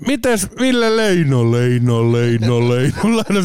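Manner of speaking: slow name call repeating as it echoes away; echoing announcer. Retold or imitated. Announcer's voice circling the hall, echoing repeats. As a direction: let the name bounce off the arena walls. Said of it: "se ääni lähtee kiertää".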